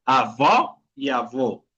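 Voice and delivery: first "avó" open and rising, second "avó" closed and falling; slow contrast of the final o in "avó"; open then closed. Shown as a demonstration. Teacher correcting a student, "avó" open first, then closed.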